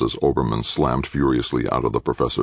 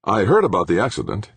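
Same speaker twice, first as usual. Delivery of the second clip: higher, light and offhand; casually light; 7 semitones higher, 4 dB louder than usual. A narrator's reading. Engineer brushing off news of the accident, casually light. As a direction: toss it off like small talk. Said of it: "he said, almost as lightly".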